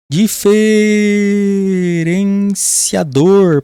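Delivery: drawing out diferenciador syllable by syllable; drawn-out word. Shown as a demonstration. Teacher stretching the word for the class, slowly. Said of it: "É um sinal diferenciador".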